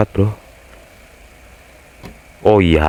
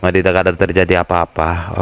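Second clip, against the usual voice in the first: ordinary talk, nothing marked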